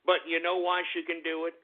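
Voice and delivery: normal talking voice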